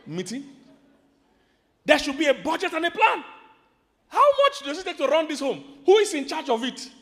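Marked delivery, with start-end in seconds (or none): 1.8-6.9 loud, raised preaching questions; loud pressing questions. Real, not acted. Preacher pressing couples on money, loud. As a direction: demand answers from the congregation.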